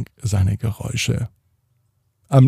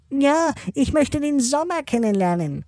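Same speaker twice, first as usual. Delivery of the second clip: squawky bouncy penguin; 3 dB quieter than usual. Acted. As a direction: play a cheerful little penguin, very high. Penguin character speaks.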